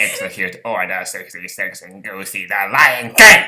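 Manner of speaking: loud cackling Sith command; Palpatine voice. Pretend. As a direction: bellow it like a mad emperor. Palpatine bit, loud, laughing.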